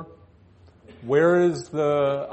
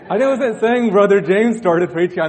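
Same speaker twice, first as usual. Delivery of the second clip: laughing; 7 semitones higher, 10 dB louder than usual. Real, not acted. Laughs at his own joke.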